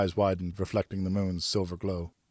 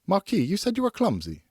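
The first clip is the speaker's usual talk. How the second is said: put-on higher, lighter young lady's voice; Geneviève's voice; 12 semitones higher than usual. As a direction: speak as a graceful young noblewoman.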